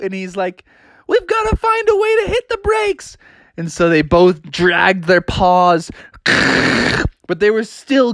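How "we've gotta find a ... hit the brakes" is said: high squeaky urgency; high urgent cub voice. Acted. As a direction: pitch it up like a frantic cub. Bubby urgent.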